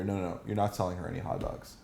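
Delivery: quick, quiet, serious refusal; understated stern order; mock-serious command. Shown as a demonstration. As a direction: forbid the vendor, softly and fast. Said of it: "It's very serious".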